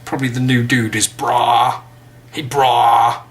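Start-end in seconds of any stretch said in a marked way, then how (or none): 2.3-3.2 lightly put-on, louder bro greeting; mock American bro. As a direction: call out like an American buddy. Imitating American slang greeting.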